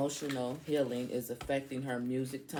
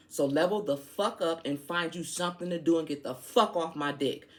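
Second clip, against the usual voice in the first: ordinary delivery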